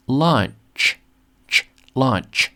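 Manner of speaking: "launch" with the ch split off and released clearly; final ch emphasized. Shown as a demonstration. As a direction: sound out "launch", isolating its ending ch. Teacher isolates final ch sound.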